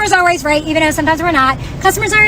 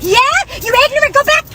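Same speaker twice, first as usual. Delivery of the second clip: retold screaming; 7 semitones higher than usual. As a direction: shout him pushing her out the door, hammed up. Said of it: "Yelling, screaming at me".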